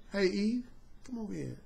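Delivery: soft, low smooth talker; low murmured pickup line, coaxing; smooth serpent. Retold or imitated. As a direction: sweet-talk her quietly like a smooth operator. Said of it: "that serpent was smooth".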